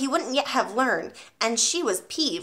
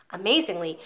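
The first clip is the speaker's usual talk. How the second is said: ordinary delivery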